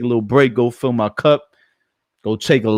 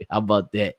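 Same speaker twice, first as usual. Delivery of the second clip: normal talking voice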